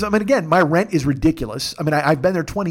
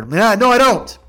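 higher, louder indignant comeback; reenacted outraged protest; retold angry refusal; 6 semitones higher, 6 dB louder, slower than usual. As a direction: fire back, acting out being mad. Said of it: "I'm so fucking mad".

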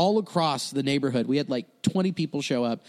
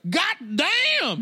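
very high, stretched-out exclamation; shocked nurse; 13 semitones higher than usual. Retold or imitated. Atlanta nurse sees the exploding boil, mock shock. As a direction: recoil in hammed-up horror. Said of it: "That's very Atlanta".

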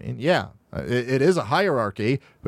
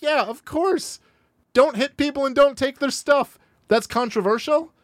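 high-pitched mock disbelief; incredulous; 12 semitones higher than usual. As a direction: say it much higher, as if astonished anyone objects. Incredulous at the opponent's scorn.

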